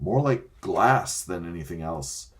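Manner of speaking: plain reading voice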